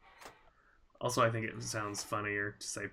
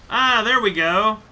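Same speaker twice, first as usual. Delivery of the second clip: excited; 9 semitones higher, 12 dB louder than usual. Real, not acted. Success in game puzzle.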